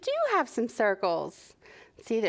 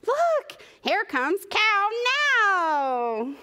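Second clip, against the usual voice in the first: high, slow, stretched-out cheer; thrilled storybook character, pitched way up; excited announcement; 13 semitones higher, 4 dB louder, much slower than usual. Acted. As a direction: call out the big arrival. Friends announce Cow's arrival.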